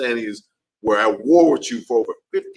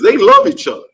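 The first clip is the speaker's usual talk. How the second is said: high loud outburst; 9 dB louder than usual. Real, not acted. Ranting that others stick together.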